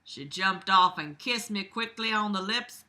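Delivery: ordinary delivery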